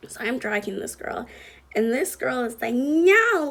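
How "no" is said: high, loud imitated wail of refusal; mock protesting yell. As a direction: yell it out, mimicking her protest. Imitating the girl being dragged off.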